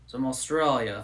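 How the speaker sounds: mock Aussie introduction; Australian accent. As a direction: play at being Australian. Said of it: "I love Australian accents".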